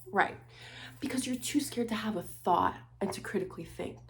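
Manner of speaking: ordinary talk, nothing marked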